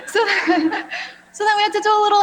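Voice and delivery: real laughter breaking into the sentence; laughing. Real, not acted. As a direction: still giggling through the words. Laughing after child's remark.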